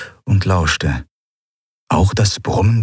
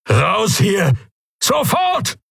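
urgent yell climbing higher at the end; bellowed order; 10 semitones higher, much slower than usual. Acted. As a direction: admiral yelling an evacuation, last word highest. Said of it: "brüllte er". (gebrüllter, dringender Befehl)

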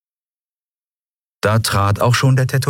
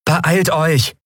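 urging; 11 semitones higher than usual. Acted. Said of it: "drängte Endriel".

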